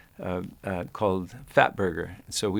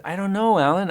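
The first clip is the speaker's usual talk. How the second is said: meek high-pitched reply; 11 semitones higher, 6 dB louder than usual. Retold or imitated. Actor answering furious director.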